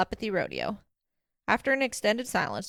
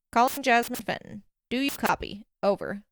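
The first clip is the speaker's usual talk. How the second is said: plain reading voice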